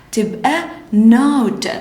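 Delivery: drawn-out, sound by sound; slow phonics blend. Shown as a demonstration. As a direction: blend it slowly for the class. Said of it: "ن، O، ت".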